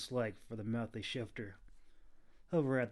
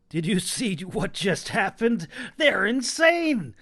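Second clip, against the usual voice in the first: loud, high panic, slowing on the end; panicked shout; 9 semitones higher, 12 dB louder than usual. A narrator's reading. Clove yelling in panic at Kita about the fairies. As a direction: yell in horror at the madness.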